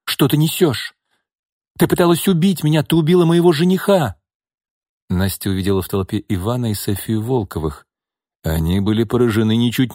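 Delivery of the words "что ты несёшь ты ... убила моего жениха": higher, louder, fast accusing cry; frightened outburst. Acted. Lyudmila accuses her sister of murder, shrill and fast. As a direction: fling the accusation at her, panicked.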